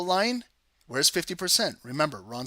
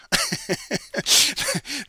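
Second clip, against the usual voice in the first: a burst of high-pitched chipmunk babble; high squeaky chipmunk chatter; 10 semitones higher, 3 dB louder than usual. Pretend. Trader jokes he's an auctioneer in a squeaky chipmunk voice. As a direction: rattle off a squeaky sped-up auction call. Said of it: "If you guys ever hear that chipmunk voice".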